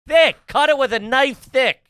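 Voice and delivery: ordinary delivery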